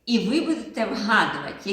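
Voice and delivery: usual speaking voice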